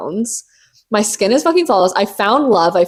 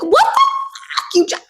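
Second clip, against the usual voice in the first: high squeal of disbelief; high shocked mock exclamation. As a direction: gasp it out like a shocked friend. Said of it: "the girls would be like".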